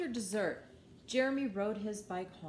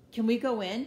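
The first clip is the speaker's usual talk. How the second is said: eager question; 2 semitones higher, 10 dB louder than usual. Acted. Jeremy asks eagerly.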